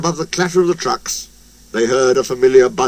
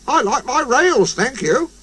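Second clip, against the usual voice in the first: prim little engine voice; 9 semitones higher than usual. A narrator's reading. Percy's prim reply.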